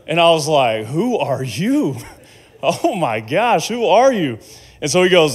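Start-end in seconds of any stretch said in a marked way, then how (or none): 0.8-4.4 slow, rising-pitched amazement; acted-out incredulous awe; astonished disbelief. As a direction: draw it out, utterly floored. Astonished reaction to a stranger's prophecy.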